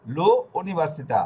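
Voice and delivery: slow লো, slight gap, then ইউনিভার্সিতা; teacher's deliberate, slow লো ইউনিভার্সিতা; pronunciation demo. Shown as a demonstration. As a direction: sound out "লো ইউনিভার্সিতা" slowly, vowel carried onto the L. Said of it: "অক্ষর ভেবে উচ্চারণটা করব".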